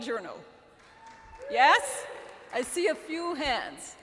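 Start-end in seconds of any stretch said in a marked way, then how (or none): 1.5-4.0 loud call out to the crowd; shouted question to the audience. Real, not acted. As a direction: throw the question out to the room, loud. Asking the audience for hands.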